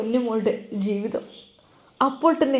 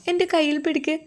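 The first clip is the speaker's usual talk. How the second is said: put-on small girl's request; 7 semitones higher than usual. Girl asking to hold hand.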